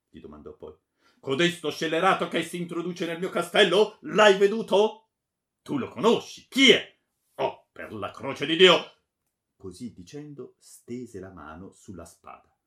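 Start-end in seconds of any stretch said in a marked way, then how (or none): 1.2-8.8 loud, high, agitated questioning; furious interrogation. A narrator's reading. Baron interrogating the friar about the intruder, furious. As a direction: fire off angry questions, ending on an oath.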